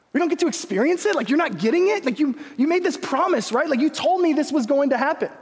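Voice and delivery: high, indignant pleading; protesting kid. Retold or imitated. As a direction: act out a let-down boy holding dad to a promise. Boy confronts father over broken promise.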